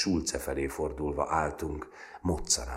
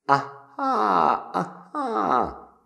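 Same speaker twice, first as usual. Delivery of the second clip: very high amused aha; high knowing aha; mock realization; 8 dB louder, much slower than usual. A narrator's reading. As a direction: nod along knowingly. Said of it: "bólogatott".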